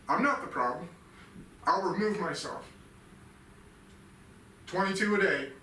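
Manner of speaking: loud, high, building self-directed rant; real anger spilling out; rising anger. Real, not acted. Raging at himself, self-blame.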